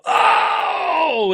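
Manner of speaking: high, drawn-out "oh!" of horror; mimicked collective gasp-shout; imitated crowd scream. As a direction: cry out like a shocked pit crowd. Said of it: "they're all screaming".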